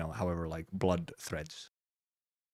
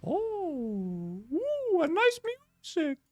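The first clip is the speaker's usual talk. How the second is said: soft, very high drawn-out ooh; delighted cooing; 6 dB quieter, much slower than usual. Real, not acted. Reactor admiring the trailer's music, cooing. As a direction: coo with delight, slowly and high-pitched.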